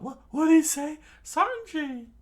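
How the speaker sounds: very high, very loud shocked scream. Acted. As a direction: scream in disbelief. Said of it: "the chef, uh, screamed out".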